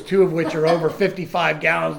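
ordinary talk, nothing marked

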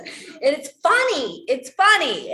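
loud, high, drawn-out insistence; emphatic amusement. Real, not acted. Insists her teasing is funny.

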